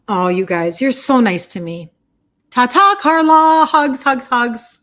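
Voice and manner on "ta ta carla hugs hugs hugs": higher and much louder sing-song farewell; cheerful goodbye. Real, not acted. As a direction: send her off with big bright hugs. Waving goodbye to a viewer.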